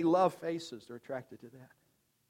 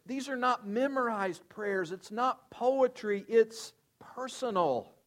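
much higher, puzzled voice slowing on poetry and personal; astonished disciples; 7 semitones higher, slower than usual. Retold or imitated. Disciples asking Jesus how to pray, in a high amazed voice. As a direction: sound amazed at a brand-new way to pray. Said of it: "this is what the disciples are like".

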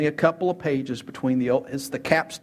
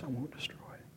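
hushed reply; 2 semitones lower, 19 dB quieter, faster than usual. Acted. God's answer voiced to Abraham.

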